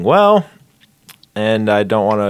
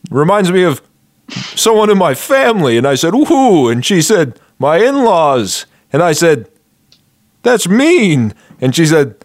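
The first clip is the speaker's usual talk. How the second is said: high, loud joke-telling voice; stand-up comic voice; 5 semitones higher, 4 dB louder than usual. Acted. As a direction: deliver it like a club comic.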